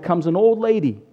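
ordinary talk, nothing marked

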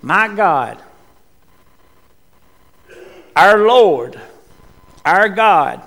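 loud anguished cry of "My God"; Jesus on the cross crying out loud to God. Acted. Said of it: "when he was crying out".

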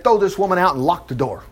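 normal talking voice

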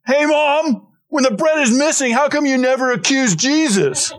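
high-pitched mock child's protest to mom; imagined complaining kid. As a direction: play a kid calling out an accusation, pitched well up. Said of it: "the kids saying".

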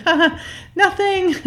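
a high, forced little laugh through the words; awkward fake laugh. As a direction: cover the embarrassment with a nervous chuckle. Retold awkward laugh line.

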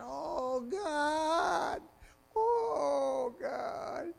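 a hammed-up wail of Oh God; mock whining. Believers whining at God over money, mock wail. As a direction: whine it like a hint of crying. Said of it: "we immediately start whining at God".

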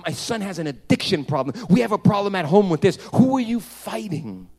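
preaching at full force. Acted. Pastor pressing the congregation on who they fight, preaching at full force. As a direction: build the climax and sing out the last lines. Pastor's climactic altar call.

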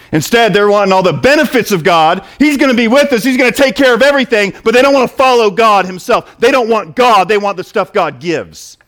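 high, loud, driving preacher's cry; preaching at full force. Acted. Preacher to his church, loud and high. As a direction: hammer it home to the congregation.

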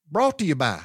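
"Brought to you by" blurred into one quick word; run together as one word. Retold or imitated. Quoting the TV sponsor line as a child heard it, one word. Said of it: "It was just a word".

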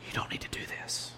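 fast, urgent hushed whisper. Retold or imitated. The Abigail in your life whispering a warning. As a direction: whisper the warning close to the ear.

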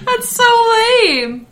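high, slow, laughing out the words. Real, not acted. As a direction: giggle through it. Laughs at the silly name.

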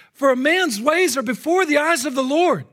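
loud, high preached verse; ringing pulpit declaration; impassioned scripture proclamation. Real, not acted. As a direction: proclaim the verse urgently to the whole room. Same verse preached at full force.

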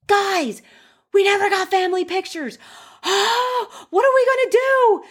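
very high, mock-frantic plea; high-pitched retold bridal meltdown; acted panic. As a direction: gasp and squeal it in re-enacted panic. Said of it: "I immediately felt my stomach drop".

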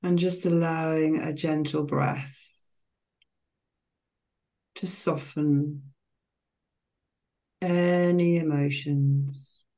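slow, calm meditation voice with long pauses between phrases; guided meditation. Real, not acted. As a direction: guide listeners gently into relaxation, phrase by unhurried phrase.

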